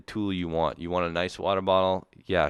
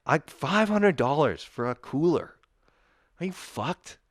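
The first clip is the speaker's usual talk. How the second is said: high-pitched disbelief; pitched-up angry disbelief; incredulous outrage; 8 semitones higher than usual. Real, not acted. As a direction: demand it in comic outrage. Ranting about cooler prices.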